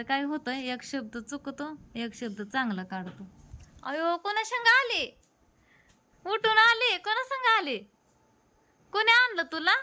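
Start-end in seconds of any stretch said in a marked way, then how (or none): 3.8-9.7 very high, loud greeting voice; high-pitched cooing. Real, not acted. Mother asks the new arrival who brought them, high cooing. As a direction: coo loudly at the little newcomer, pitched way up.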